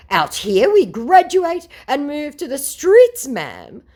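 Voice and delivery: high, loud, slow mock lament; mock scammer. Scammer claiming graduates live on the streets, high and mocking. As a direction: ham up his hard-luck story. Scammer's sob story voiced.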